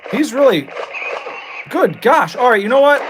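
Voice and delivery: high and loud, flustered; frantic exasperation. Real, not acted.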